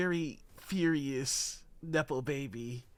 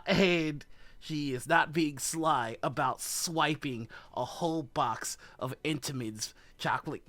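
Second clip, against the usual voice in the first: ordinary talk, nothing marked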